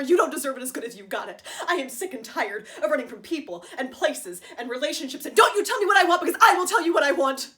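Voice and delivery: loud, impassioned tirade building to a shout; furious outburst. Acted. Wife telling George she is sick of running, furious outburst. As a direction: let it explode, peaking high and very loud.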